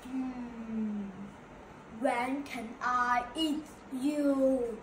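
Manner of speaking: slow, drawn-out wolf voice; low pondering hum into a slow question; hungry wolf. Acted. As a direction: menace the prey slowly, in no hurry. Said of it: "asked the hungry wolf".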